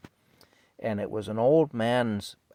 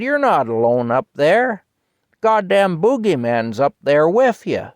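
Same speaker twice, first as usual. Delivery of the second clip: high, very loud farmer's holler; old man hollering; 8 semitones higher, 12 dB louder than usual. Retold or imitated. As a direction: yell it upstairs as the old man. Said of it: "the old man says".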